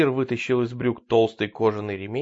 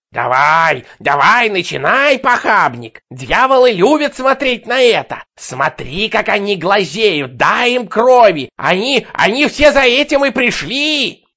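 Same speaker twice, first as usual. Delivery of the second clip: hysterical shouting; 9 semitones higher, 11 dB louder, slower than usual. Acted. Madwoman taunting on the asphalt.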